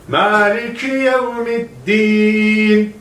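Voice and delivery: yevmiddin with the final din pressed hard, slow chant. Shown as a demonstration. Teacher stresses din so students don't say tin. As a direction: lean heavily on din in yevmiddin, a clear d not t. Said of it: "Bir daha düzgün söylüyorum".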